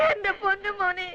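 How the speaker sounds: sobbing plea to the goddess; mother weeping over her son, crying. Acted. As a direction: cry the words out, voice breaking. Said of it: "എന്തിനാ കരഞ്ഞ് ബഹളം ഉണ്ടാക്കുന്നേ".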